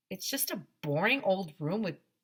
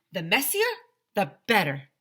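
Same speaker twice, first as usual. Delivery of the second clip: eager exclamation; 6 dB louder than usual. Acted. Custodian's eager quote.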